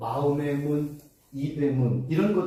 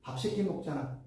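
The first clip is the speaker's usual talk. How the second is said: God urging; 2 semitones lower, 7 dB quieter than usual. Retold or imitated. God voiced urging more prayer.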